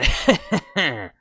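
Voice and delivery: mock Watto laugh. Copying the game character's laugh, high and loud. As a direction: attempt the alien's laugh. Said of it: "the laugh did not work".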